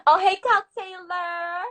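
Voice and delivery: loud, drawn-out hello; excited greeting. Real, not acted. Greeting a viewer who just joined, excited. Greeting someone joining the livestream.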